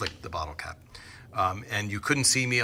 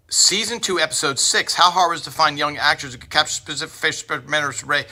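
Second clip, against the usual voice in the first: fast, high auctioneer patter; 4 semitones higher, faster than usual. Retold or imitated. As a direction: rattle it off like an auctioneer calling bids. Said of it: "that was me doing a, uh, impression of an auctioneer".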